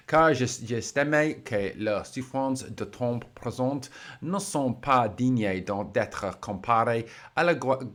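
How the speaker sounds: slow, careful reading of a French Bible verse in a clumsy English-speaker's French accent; awful French accent. Shown as a demonstration. Vlogger reads the player's French Bible verse aloud, awful French accent. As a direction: read the French slowly, mangling the French accent a little. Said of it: "Sorry for the awful French accent".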